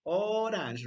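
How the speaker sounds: "Orange" drawn out clearly; teacher's slow, clear "Orange"; model for repeating. Shown as a demonstration. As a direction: stretch "Orange" for learners to copy. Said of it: "repeat after me".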